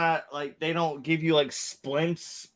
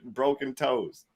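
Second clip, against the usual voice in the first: ordinary delivery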